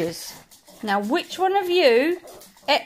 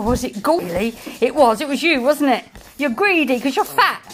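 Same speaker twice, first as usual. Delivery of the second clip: normal talking voice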